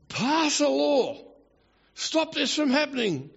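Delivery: high-pitched, hammed-up urging; mock urgent plea. Adviser begs king for a law, mock urgent. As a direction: plead as a panicky adviser.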